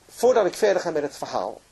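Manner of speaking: ordinary delivery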